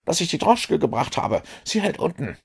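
put-on young Wiggins standing to attention, reporting; eager street boy. A narrator's reading. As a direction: snap to attention and report brightly. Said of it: "sagte er, eine stramme Haltung annehmend".

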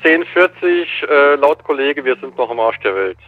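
ordinary talk

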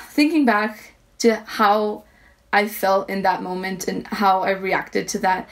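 usual speaking voice

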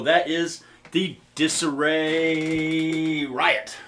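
slow and stretched, savoring each word; slow drawn-out. Real, not acted. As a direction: draw out the pedal name slowly. Announcing the pedal name.

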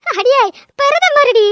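slow, high, hammed-up amazement; slow mock reverence; mock awe. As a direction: gasp it out in fake awe. Mock awe at prime minister.

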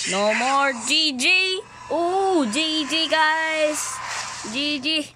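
high, loud, thrilled shouting; excited gamer. Real, not acted. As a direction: cheer the win to the viewers. Winning the match.

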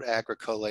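Agricola as AG-ruh-KOH-luh; discarded pronunciation. Shown as a demonstration. Librarian throwing out the old form, Agricola said as AG-ruh-KOH-luh. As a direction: say Agricola as "agri-cola", long O in the third syllable. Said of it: "stick to that pronunciation".

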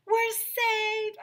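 put-on very high girlish cry; relieved yell. Andrea yelling with relief at the rescue, very high. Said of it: "Andrea yelled".

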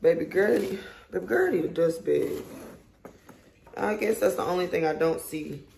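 ordinary talk

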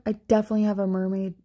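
ordinary talk

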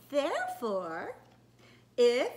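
slow, drawn-out princess voice; put-on princess, word by word. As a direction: recite it grandly like a princess. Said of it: "in a princess voice".

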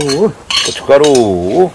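slow, stretched echo, rising at the end; drawn out. Real, not acted. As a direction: stretch 고춧가루 out long and let it rise. Echoing the ingredient while adding.

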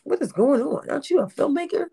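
imitated shocked, high-pitched questioning; a little disdain. Imitating a former extra questioning a filmmaker, shocked disdain. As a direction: mimic an appalled, faintly scornful questioner. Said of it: "there was a little disdainment".

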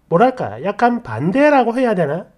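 ordinary delivery, nothing marked

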